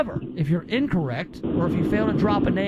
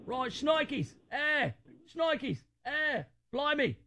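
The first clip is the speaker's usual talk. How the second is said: higher, softer mock Australian accent; 6 semitones higher, 7 dB quieter, slower than usual. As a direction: lampoon the Aussie croc hunter quietly. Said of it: "he talked like this".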